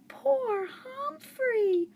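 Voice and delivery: put-on high, slow, tearful girl's voice; girl hugging her hurt hamster, close to tears; sorry and tearful. A narrator's reading. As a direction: ache with pity for the hamster.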